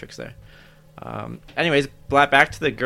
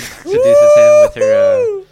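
much higher, louder and slow hammed-up seductress; mock seductive voice; 14 dB louder, much slower than usual. Retelling the cat-outfit seduction scene to friends, hammed-up sultry voice. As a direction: camp it up like a cartoon temptress purring. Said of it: "that's gonna be the thumbnail".

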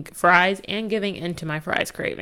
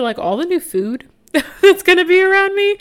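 much higher and louder, squealing with delight; giddy excitement; 13 semitones higher, 7 dB louder than usual. Real, not acted. Vlogger gushing about food near her new apartment, high and giddy.

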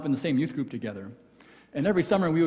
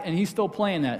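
usual speaking voice

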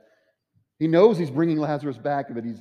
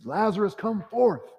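higher, ringing summons; commanding call; 9 semitones higher than usual. Acted. Jesus calling Lazarus out of the tomb, in a higher, ringing summons. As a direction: call the dead man out of the tomb.

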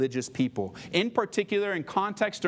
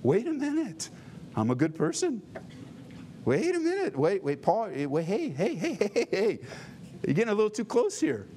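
higher, flustered mock protest; defensive protest; 4 semitones higher than usual. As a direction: squirm as if Paul hit a nerve.

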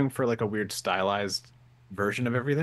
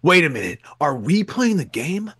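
dramatic revelation. Retold or imitated. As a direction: deliver it like a movie twist. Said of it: "they're like".

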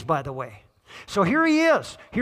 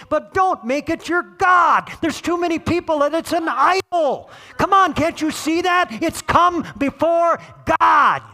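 preaching shout; 8 semitones higher, 5 dB louder than usual. Real, not acted. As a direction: thunder it at the congregation as a warning. Preacher's climax about idols.

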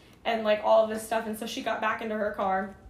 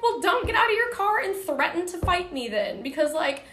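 heated comeback; 6 semitones higher, 4 dB louder than usual. Retold or imitated. Narrator voicing own heated comeback.

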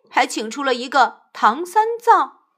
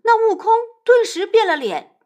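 ordinary delivery, nothing marked

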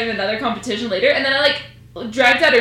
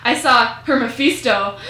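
ordinary delivery

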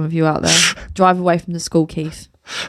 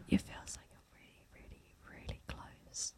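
whispering; 27 dB quieter than usual. Shown as a demonstration. Whispering about ASMR closeness.

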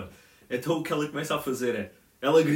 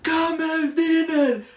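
high, loud, drawn-out mock holler; stretched-out high-pitched call to dinner; old woman shouting; 14 semitones higher, 8 dB louder, much slower than usual. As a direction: imitate an old woman yelling through the house. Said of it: "só vias a velha do outro lado a dizer".

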